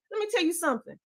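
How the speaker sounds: ordinary talk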